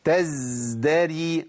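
تزدري drawn out very slowly. Shown as a demonstration. Sheikh slows the word so the student hears the ز. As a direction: stretch تزدري so the ز is clear. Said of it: "والشيخ بيقول لي قول".

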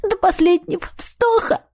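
high, tearful rising vow; cracking tearful heartbreak. Acted. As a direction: swear it through tears.

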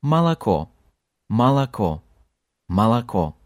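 Молоко: first two O's like A, last one a full O; reduced unstressed O. Shown as a demonstration. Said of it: "the others sound like A".

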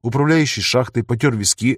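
usual speaking voice

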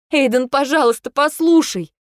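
high, loud pleading; wife desperately begging husband to listen; desperate plea. A narrator's reading. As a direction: cry out for him to listen.

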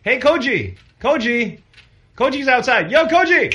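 high calling out to Koji. Real, not acted. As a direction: call him from across the room. Calling out to boss.

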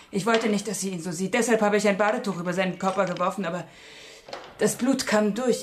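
mother driven to near hysteria recalling the blood; rising anguish. Acted. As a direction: let the horror burst out as you relive it. Mother reliving finding dead son.

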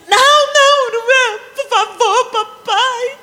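put-on girl begging her dad not to take her to the room, whining high; child's dramatic pleading. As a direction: play the little girl wailing no. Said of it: "Aquele drama".

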